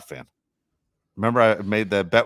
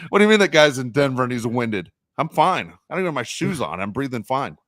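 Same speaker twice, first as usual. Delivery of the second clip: mock indignation, higher and starting loud; upset fan; 5 semitones higher, 4 dB louder than usual. Couch fan scoffing at a winded player, mock upset. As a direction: protest like a worked-up armchair critic. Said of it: "you get all upset".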